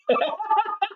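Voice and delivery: short high burst of laughter; a quick real laugh at her own joke. Real, not acted. Host laughing at own line.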